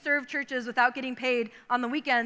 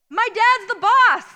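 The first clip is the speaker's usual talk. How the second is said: higher, louder, slow proud claim; triumphant kid; 8 semitones higher, 9 dB louder, slower than usual. Retold or imitated. Boy telling driver his dad is boss, slow loud triumph. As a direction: lay down the trump card. Kid's decisive answer.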